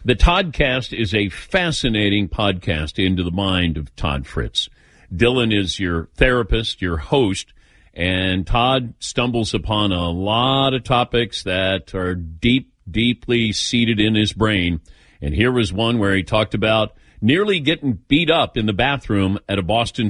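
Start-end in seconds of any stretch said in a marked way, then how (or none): none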